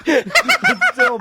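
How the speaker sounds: high, slow, laughing through pain; cracking up while hurting. Real, not acted.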